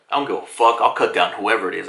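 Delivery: low, cold-blooded threat, retold; stern. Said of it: "he's always been this stern".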